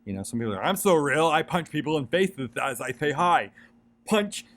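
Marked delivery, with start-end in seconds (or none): 0.6-3.5 high, loud, swaggering mock tough guy; weird impression of a fake tough guy. Mocking people who act too real, a loud put-on tough-guy voice. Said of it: "that was a weird impression". 4.0-4.4 a sudden high yelled punch; mock punch shout. Still in the impression, yelling punch high and loud. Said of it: "that was a weird impression".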